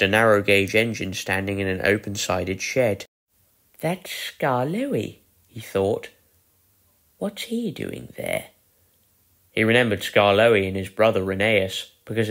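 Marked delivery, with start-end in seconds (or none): 3.8-5.2 Edward's thought. A narrator's reading. Edward thinks to himself. 7.2-8.5 Edward's thought. A narrator's reading. Edward wonders to himself.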